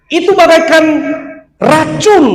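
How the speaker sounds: preaching shout. Real, not acted. Preacher warning congregation.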